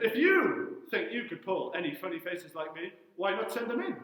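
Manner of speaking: ordinary delivery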